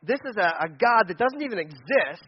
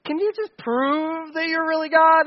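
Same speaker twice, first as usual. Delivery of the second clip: pleading doubter; 8 semitones higher, 3 dB louder, slower than usual. Retold or imitated. Gideon doubting God.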